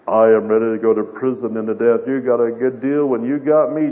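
loud, low-pitched cocky declaration; Peter boasting. Acted. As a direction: proclaim it big and low, full of self-confidence. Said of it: "remember what he had said the last time".

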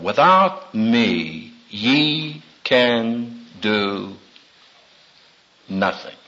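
very slow and low, each word weighed; solemn quote of Jesus. Retold or imitated. Preacher slowly quoting Jesus, solemn and hushed. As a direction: let the Lord's words sink in slowly. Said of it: "fell from the lips of our Lord".

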